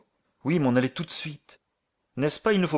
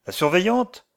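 ordinary talk

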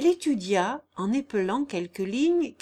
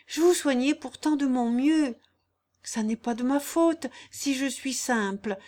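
higher-voiced simple girl, earnest and hurt; pleading, devoted Jeanne; 6 semitones higher, 4 dB louder than usual. Jeanne kneeling by Guillaume's bedside, pleading. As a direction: plead innocence while soothing him.

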